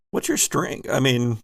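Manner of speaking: usual speaking voice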